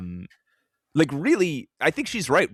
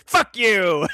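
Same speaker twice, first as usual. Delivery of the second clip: icy taunting kiss-off, a bit louder; Emma Frost voice; 4 semitones higher, 5 dB louder than usual. Retold or imitated. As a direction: snap it like a cold villain. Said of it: "She's like".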